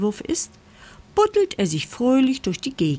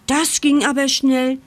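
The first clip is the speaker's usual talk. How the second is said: higher, mildly astonished remark; amazed; 5 semitones higher, 4 dB louder than usual. A narrator's reading. Mole arrives home sooner than expected, amazed. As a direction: raise the voice in mild wonder. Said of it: "staunt Moritz".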